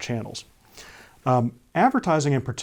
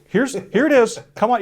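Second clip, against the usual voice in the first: mock eager invite; 11 semitones higher, 7 dB louder than usual.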